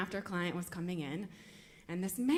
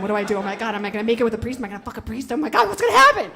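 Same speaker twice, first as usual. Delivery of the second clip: spiral into frantic panic; panicked outburst; 13 dB louder, much faster than usual. Retold or imitated. Panicking about sleeping with priest.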